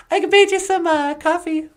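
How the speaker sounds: very high, bright greeting; a cheerful high puppet hello; Natasha's puppet voice. Acted. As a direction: pitch way up for the puppet. Puppet Natasha offers coffee.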